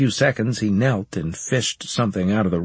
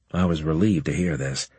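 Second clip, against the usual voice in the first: ordinary delivery, nothing marked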